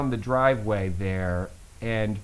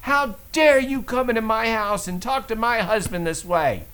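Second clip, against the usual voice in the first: yelling back; 8 semitones higher, 5 dB louder than usual. Retold or imitated. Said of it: "she starts yelling back at him".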